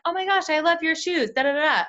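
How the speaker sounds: high, bubbly stranger-friendly gush; gushing compliment. Retold or imitated. Chatting up the person behind in line, bubbly and high. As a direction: gush over her shoes to spark a chat. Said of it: "Being like".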